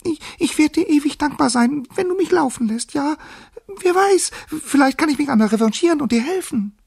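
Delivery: high, loud, eager mouse voice; submissive squeak. A narrator's reading. Mouse promising the lion help if freed, squeaking. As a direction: squeak an earnest, pleading promise. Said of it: "piepste die Maus unterwürfig".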